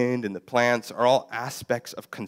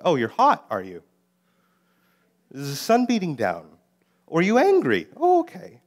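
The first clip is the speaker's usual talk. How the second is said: higher, louder, playfully needling; teasing mock surprise; 7 semitones higher, 6 dB louder than usual. God gently teasing the sulking Jonah, higher, louder and playfully mocking. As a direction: tease him with exaggerated mock surprise that climbs up high on okay.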